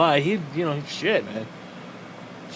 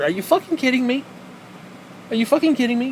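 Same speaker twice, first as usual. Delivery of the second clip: exasperated disbelief; 6 semitones higher, faster than usual. Real, not acted. Exasperated rant at TV idea.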